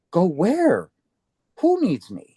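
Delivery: high, startled questions; baffled surprise. Retold or imitated. To the stranger who said someone needs him, high startled asking. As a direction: caught off guard, asking where and who. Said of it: "And I'm like".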